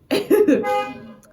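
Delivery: high, loud burst of laughter. Real, not acted.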